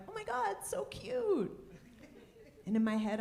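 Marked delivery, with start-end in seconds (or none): 0.1-1.5 put-on high gushing coo over a baby. Strangers cooing at her new baby, high and gushing. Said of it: "People coming up to me like".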